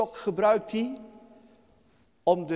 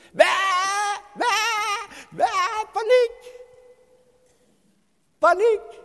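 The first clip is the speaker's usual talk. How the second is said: panicked sheep; 11 semitones higher, 6 dB louder than usual. Retold or imitated.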